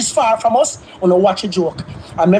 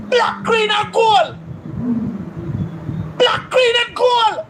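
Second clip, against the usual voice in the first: patriotic cry; 8 semitones higher, slower than usual. Real, not acted.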